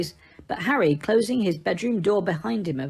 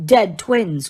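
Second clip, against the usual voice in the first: ordinary delivery, nothing marked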